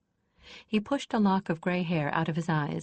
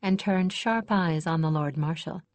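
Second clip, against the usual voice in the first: usual speaking voice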